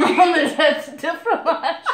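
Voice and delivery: slow hammed-up French, laughing; over-the-top French accent. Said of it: "people know how to say in French".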